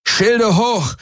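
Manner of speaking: the pilot yells to raise the shields; shouted order. A narrator's reading. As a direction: shout over the attack. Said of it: "brüllte Garland".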